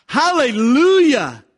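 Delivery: very high, loud, drawn-out praise shout; thunderous hallelujah, slow and ringing; crying out. Acted. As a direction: lift it like thunder over the crowd. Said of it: "crying out".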